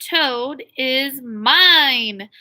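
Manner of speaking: drawn-out title, slow; slow, deliberate book title; slow title read. Shown as a demonstration. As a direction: savor each word of the title.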